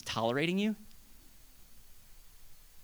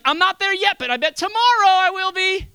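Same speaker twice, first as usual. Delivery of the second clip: much higher and louder, bright excuse; loud putting-off; 8 dB louder than usual. Pretend. Believer lured by the enemy, putting it off loudly. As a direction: cheerfully put it off till tomorrow.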